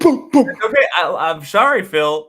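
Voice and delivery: high, loud, still laughing; laughing apology. Real, not acted. Mock apology to [name], still laughing.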